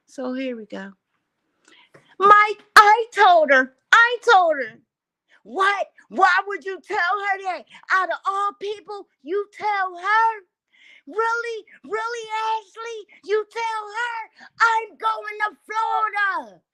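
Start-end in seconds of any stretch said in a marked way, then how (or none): 2.2-4.8 mock shrill wail, much higher and louder; high pleading cry. Ashley's voice, pleading loudly. 5.4-16.6 put-on much higher, whining like a sulky child; whiny kid voice. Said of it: "acting like a kid".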